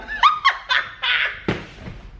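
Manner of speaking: real high-pitched burst of laughter; hard laughing fit. Real, not acted. Storyteller cracking up at the question, laughing hard. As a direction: crack up helplessly, high and long. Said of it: "I'm sorry I'm laughing so hard".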